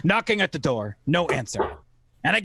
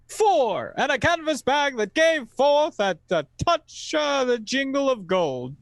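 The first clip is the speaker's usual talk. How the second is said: raised, counting each item; 10 semitones higher than usual. A narrator's reading. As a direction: count them out, building. Counting items in the chest.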